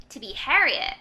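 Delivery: ordinary talk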